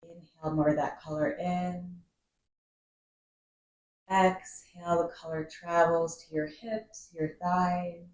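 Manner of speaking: guided meditation. Acted. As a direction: guide the breath calmly, drawing out key words and letting phrases settle. Leads a seated breathing meditation.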